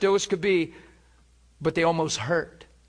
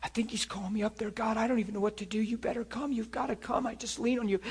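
soft, hushed plea to God; a hint of desperate whisper-prayer; quiet inner prayer; 2 semitones higher, 7 dB quieter, faster than usual. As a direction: pray under your breath, anxious. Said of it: "in my heart, I said".

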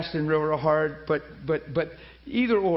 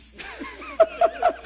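gleeful laugh. Retold or imitated. Preacher reliving breaking his fast, gleeful laugh. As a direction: burst out laughing with relief.

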